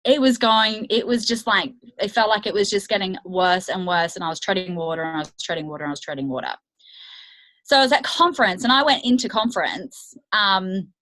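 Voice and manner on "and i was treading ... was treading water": each repeat lower and softer; trailing off, lower and quieter; fading repetition. Real, not acted. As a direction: let it sink away each time. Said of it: "repetition fading".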